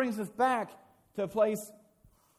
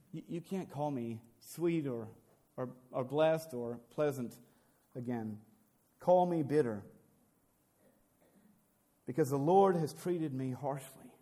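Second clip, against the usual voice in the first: bitter; 6 semitones lower than usual. Acted. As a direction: let the hurt weigh on each word. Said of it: "And she goes".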